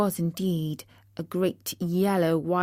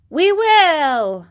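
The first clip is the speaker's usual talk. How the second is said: high, loud mouse cry; shrill, drawn-out squeaky shout; shrill chorus; 9 semitones higher, 8 dB louder than usual. A narrator's reading. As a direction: cry out shrilly like eager field mice. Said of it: "cried all the field mice in a shrill chorus".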